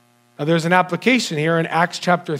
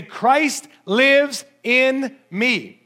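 very slow, higher, each word laid down; slow declaration; 5 semitones higher, much slower than usual. Acted. A believer declaring to the devil, very slow proclamation. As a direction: proclaim it so the enemy hears.

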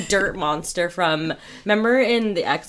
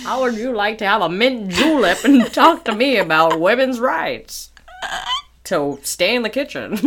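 put-on loud old-timey charmer; old-timey guy; 3 dB louder than usual. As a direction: play a smarmy old-time gentleman. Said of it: "that old-timey guy at the bar".

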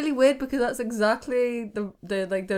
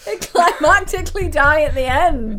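laughing; 8 semitones higher, 11 dB louder than usual. Real, not acted. Laughing at the joke.

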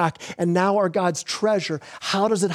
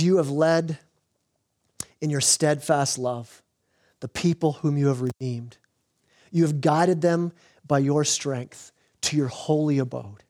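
scripture reading; 5 semitones lower than usual. Acted. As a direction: lower the voice and dwell on each phrase. Reads Exodus verses softly.

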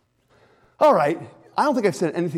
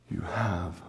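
intimate whisper; 8 semitones lower, much slower than usual. Acted. God promising peace to the listener, slow hushed whisper. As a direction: breathe it like a secret to the heart.

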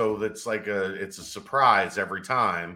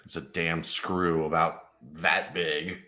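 ordinary delivery

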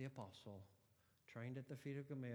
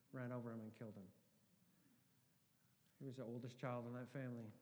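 normal talking voice

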